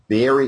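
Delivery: VERY drawn out for emphasis; stress on very. Real, not acted. Proud grandfather answering the host, very emphasized. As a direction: lean hard on the word very. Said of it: "I emphasize the word very".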